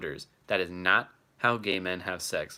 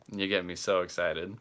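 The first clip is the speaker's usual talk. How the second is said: ordinary talk, nothing marked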